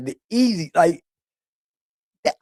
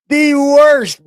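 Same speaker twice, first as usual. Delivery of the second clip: high, loud exclaim; mock the troll's attempt; higher and louder exasperation; the worst; 9 semitones higher, 5 dB louder than usual. Real, not acted. Mocking the troll.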